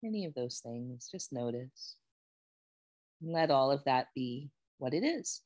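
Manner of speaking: calm, unhurried guiding voice with long pauses; guided meditation. Real, not acted. Yoga teacher guiding students to notice how they feel, meditative. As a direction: ease them gently into noticing body and breath. Leads a settling body check-in.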